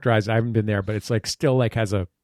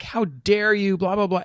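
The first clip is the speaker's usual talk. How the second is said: mock outraged scolding; 7 semitones higher than usual. Retelling tiny woman screaming at performers, mock outraged scolding. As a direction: mimic an indignant telling-off, trailing into blahs. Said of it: "This little tiny woman is screaming at us".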